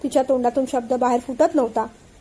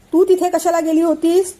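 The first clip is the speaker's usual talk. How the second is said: angry question; 4 semitones higher, 4 dB louder than usual. Acted. Husband angrily questions wife.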